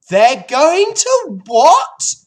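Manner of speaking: slow, very loud, high piglet scream; horrified scream. A narrator's reading. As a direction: shriek it in disbelief as the pig. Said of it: "screamed Wilbur".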